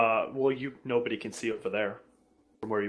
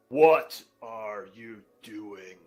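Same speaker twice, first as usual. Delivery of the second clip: low, very slow menacing voice; booming villain voice; 6 semitones lower, much slower than usual. Acted. Unseen foe threatens the intruders, deep slow villain voice. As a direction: draw it out deep and ominous. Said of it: "you hear a booming, loud voice".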